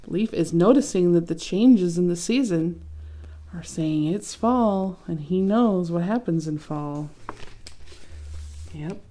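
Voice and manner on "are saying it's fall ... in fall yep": ordinary delivery, nothing marked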